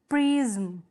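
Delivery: "प्रिज्म" stretched out as preee-zm; drawn-out syllables. Shown as a demonstration. Teacher showing two vowel sounds, "प्रिज्म" drawn out as preee-zm. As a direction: hold the vowel in "प्रिज्म", then let zm sound. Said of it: "दोनों मिलकर दो vowel sound दे रहे हैं".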